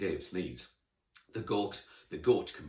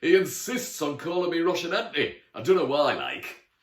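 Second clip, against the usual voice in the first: loud, higher-pitched broad Geordie; thick Geordie accent; 8 semitones higher, 9 dB louder than usual. Acted. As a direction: grumble chattily in broad Geordie. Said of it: "in a thick Geordie accent".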